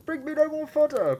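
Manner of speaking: high, piercing tiny-voice yell; high shout. Acted. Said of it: "he heard".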